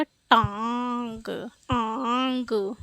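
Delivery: टांग softly stretched, then आंग alone; nasalised vowel demo. Shown as a demonstration. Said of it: "ये क्या उच्चारित होगा".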